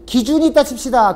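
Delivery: usual speaking voice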